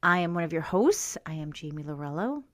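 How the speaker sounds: ordinary talk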